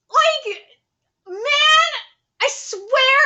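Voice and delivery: high, worked-up fan voice; thrilled exclaiming. Real, not acted. Viewer overwhelmed by the rescue, thrilled exclaiming. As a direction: gush with real excitement.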